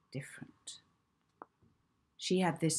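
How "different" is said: a soft unvoiced breath of a word; whispered. A narrator's reading. Narrator whispering Molly's dreamy feeling. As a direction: let the word drift out as a whisper.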